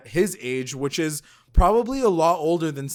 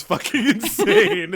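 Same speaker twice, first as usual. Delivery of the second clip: laughing; 10 semitones higher, 7 dB louder, slower than usual. Real, not acted.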